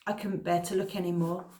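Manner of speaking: usual speaking voice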